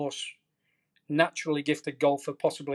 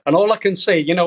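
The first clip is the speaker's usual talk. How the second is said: excited outburst; 3 semitones higher, 11 dB louder than usual. Real, not acted. Burst of excitement.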